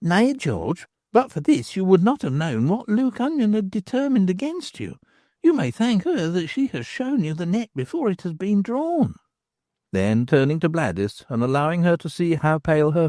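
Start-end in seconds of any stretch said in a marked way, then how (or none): 0.0-9.1 higher, earnest woman explaining; a young woman laying out what she realised; Nan's voice. Acted. Nan speaks to Stracey.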